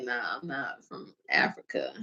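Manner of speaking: dismissive low aside. Retold or imitated. Brushing off being called African.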